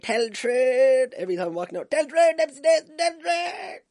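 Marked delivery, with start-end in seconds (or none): 0.0-1.1 high, loud, drawn-out yell; fans' shout. Retold or imitated. Travellers calling to [name] in the street, drawn-out shout. 1.9-3.8 very high, loud chanting yell; fans' shout. Retold or imitated. Travellers hailing [name] as he walks out, high shout. Fans calling out to him.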